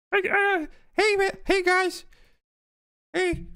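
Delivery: very high put-on falsetto; squeaky high voice. Jokes his voice isn't that deep.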